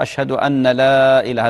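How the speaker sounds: أن لا said as "anna laa", noon sounded; faulty call with أن لا as two words, "anna laa"; wrong unmerged shahada. Shown as a demonstration. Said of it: "هذا غلط".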